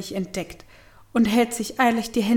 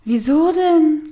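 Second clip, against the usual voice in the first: character's retort; 6 semitones higher, 6 dB louder, slower than usual. A narrator's reading.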